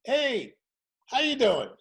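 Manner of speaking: high, bright showman hello; warm-up greeting. Retold or imitated. Warm-up man greets the studio audience, loud and high. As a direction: burst out to greet the crowd.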